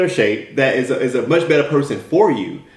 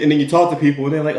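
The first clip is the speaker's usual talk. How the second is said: ordinary talk, nothing marked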